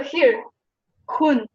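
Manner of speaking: normal talking voice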